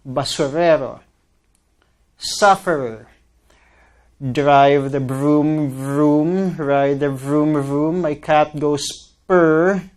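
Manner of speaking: careful R sounds, some drawn out slowly; R practice words. Shown as a demonstration. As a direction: stretch and work each R. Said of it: "Let's try this again".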